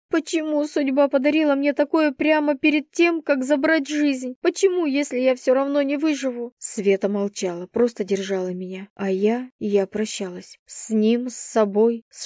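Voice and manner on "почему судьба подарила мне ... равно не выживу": high, despairing lament; anguished questioning. A narrator's reading. Dying girl asking why fate does this, anguished. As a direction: plead with fate in a raised pitch. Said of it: "шептала я".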